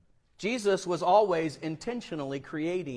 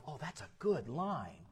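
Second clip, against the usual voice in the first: soft, private aside; hushed note to self; thinking to oneself; 4 semitones lower, 12 dB quieter than usual. Acted. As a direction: voice it softly like an inner thought. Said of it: "you thought to yourself".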